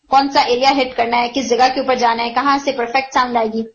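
ordinary talk

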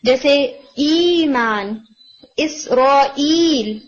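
ایمان and اسرائیل said slowly, long vowels stretched; slow example words. Shown as a demonstration. Teacher demonstrates the long sound to her class, slowly. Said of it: "sound جیسے دعائی".

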